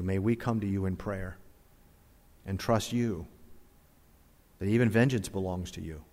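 soft, low praying voice; closing prayer. Real, not acted. Pastor asking the Father to bless the invitation, softly prayed. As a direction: speak quietly and reverently to God.

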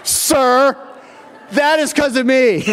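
high, loud, over-the-top boast; hammed-up triumph, belting out each word; triumphant shout. Mock-triumphant comeback to the lawyer.

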